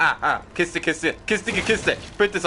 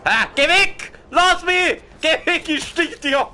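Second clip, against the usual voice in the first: panicked yelling; 9 semitones higher than usual. Real, not acted. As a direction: shriek at the zombies half laughing. Zombies attacking.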